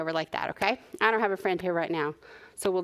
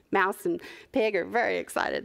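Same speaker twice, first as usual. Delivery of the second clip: ordinary delivery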